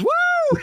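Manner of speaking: mock high whoop of joy; imitated woo. Host imitating the winner's yell, a high mock whoop. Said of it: "He goes".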